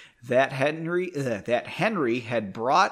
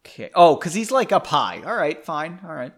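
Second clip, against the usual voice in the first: usual speaking voice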